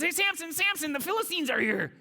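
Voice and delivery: hammed-up falsetto woman waking him; Delilah alarm. Delilah wakes Samson, alarm.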